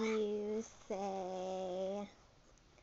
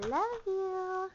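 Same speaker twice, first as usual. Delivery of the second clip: high, slow, tender coo; 7 semitones higher, 3 dB louder, much slower than usual. Real, not acted. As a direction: coo it slowly to the little one. Parent coaxing toddler to say it.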